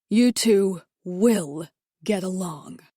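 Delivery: slow, deliberate command; husband ordering wife and mother to make peace; stern order. Acted. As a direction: lay it down as final.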